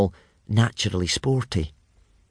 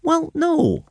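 much higher, squeaky admitting; sheepish admission; 3 dB louder than usual. A narrator's reading. Hiccup sheepishly admits to Fishlegs, much higher. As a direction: own up awkwardly. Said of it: "admitted Hiccup".

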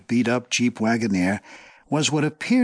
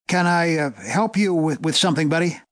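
calling out; 5 semitones higher than usual. A narrator's reading. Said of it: "he called out".

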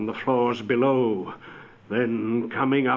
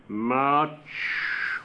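ghost voice; 2 semitones higher than usual. Acted. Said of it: "Marley's voice, no doubt".

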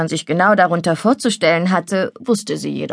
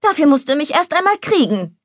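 cheeky taunt; 8 semitones higher than usual. Acted. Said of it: "Fritz lachte".

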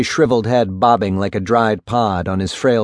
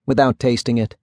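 usual speaking voice

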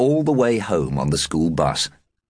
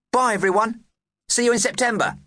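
put-on cheerful boy voice. A narrator's reading. Plughole voiced leaving bus.

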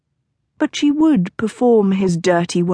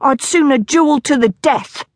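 higher and louder, defiant; outraged shout; 7 semitones higher, 3 dB louder than usual. A narrator's reading. Nico defying Marlowe to protect his sister, shouting. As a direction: challenge him to a duel in a rage. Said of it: "he shouted at Marlowe".

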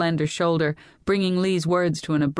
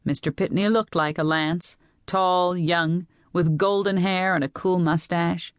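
usual speaking voice

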